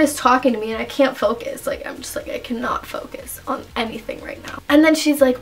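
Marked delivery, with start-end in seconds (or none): 1.7-4.6 breathless unvoiced whisper, hushed. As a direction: whisper it so the teacher can't hear. Dizzy girl whispering in class.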